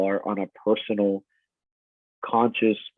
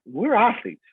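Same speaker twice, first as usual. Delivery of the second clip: usual speaking voice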